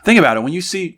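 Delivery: ordinary delivery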